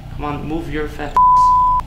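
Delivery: very loud, high, stretched final word; impatient yelling. Real, not acted. Gamer urging the character to move, impatient yelling. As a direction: yell it, drawing out the last word.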